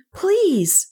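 high, polite little voice; pleading child. A narrator's reading. Kay addresses the maidservant, pleading. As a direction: appeal politely, pitched high.

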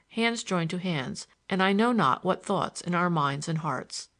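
ordinary delivery, nothing marked

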